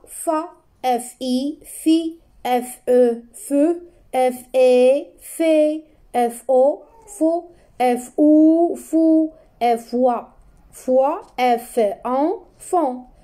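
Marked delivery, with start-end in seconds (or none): 0.1-11.2 teacher sounding out syllables; syllable reading. Shown as a demonstration. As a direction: call out each syllable clearly. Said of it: "F A".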